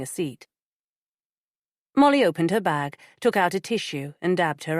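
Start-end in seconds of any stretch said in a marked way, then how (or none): none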